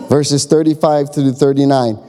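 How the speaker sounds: ordinary talk, nothing marked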